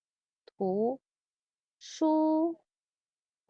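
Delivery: "图 书 馆" one syllable at a time with pauses. Shown as a demonstration. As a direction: break the word into syllables.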